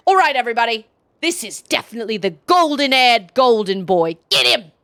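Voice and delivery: loud, gloating Cockney henchman voice; Cockney villain. Acted. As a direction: crow over trapped prey in broad Cockney. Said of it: "That was apparently the accent I decided".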